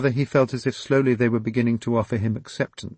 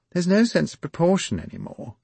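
princess agreeing; 10 semitones higher, 4 dB louder than usual. Acted. Princess voiced higher.

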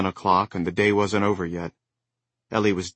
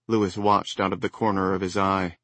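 usual speaking voice

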